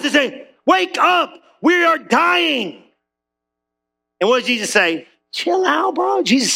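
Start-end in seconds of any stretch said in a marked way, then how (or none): 0.6-2.8 high, slow panicked cry; mock panic. Disciples panic in storm. 5.3-6.2 high, easy chill-out line; cool laid-back Jesus. Retold or imitated.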